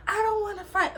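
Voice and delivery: high, whiny mock plea; imitating bawling. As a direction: mimic her tearful begging. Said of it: "Like, starts bawling".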